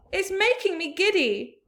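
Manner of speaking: high woozy whine; giddy complaint. A narrator's reading. Miss Spider complaining she feels giddy. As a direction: sound dizzy and fussy.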